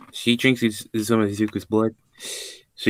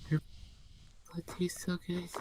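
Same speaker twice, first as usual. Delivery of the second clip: high, very soft, airy whisper; turned-on whisper; 9 semitones higher, 15 dB quieter than usual. Acted. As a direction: whisper it as if savouring a taste. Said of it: "She gets turned on and drinking his blood".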